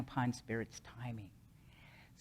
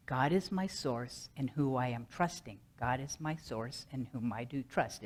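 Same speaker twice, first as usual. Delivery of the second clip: softer repeated recitation; calming mantra; 3 semitones lower, 6 dB quieter than usual. Shown as a demonstration. Speaker repeating the mantra to calm down, softer rhythmic recitation. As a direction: repeat it low-key, running the phrases along. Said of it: "over and over and over again until I calm down".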